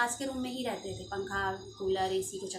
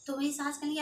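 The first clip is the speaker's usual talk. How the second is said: normal talking voice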